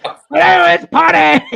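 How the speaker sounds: high, loud, drawn-out party cheer; excited kid. Retold or imitated. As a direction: imitate an eager kid, stretching the words out. Retold kid eager to party.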